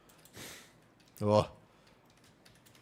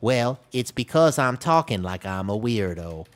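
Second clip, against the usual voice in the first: slow, exaggerated imitation of badly accented speech; mock your own foreign accent in Thai, done in English; loud, drawn-out weird foreigner accent; weirdo foreigner accent; 5 dB louder, slower than usual. Shown as a demonstration. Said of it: "That's what I sound like when I speak Thai".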